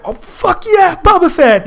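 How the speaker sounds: very high mock fanboy yell; ecstatic superfan. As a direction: explode with hammed-up fan joy. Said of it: "him running into George Lucas".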